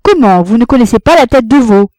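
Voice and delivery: loud, high furious outburst. A narrator's reading. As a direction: hurl it at the laughing crowd. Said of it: "Il reprit d'un ton furieux". (ton furieux)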